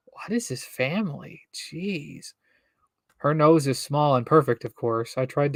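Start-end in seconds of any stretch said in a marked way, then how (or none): 0.1-2.3 softly breathed disbelief; whispered aside. Real, not acted. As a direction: whisper it to yourself.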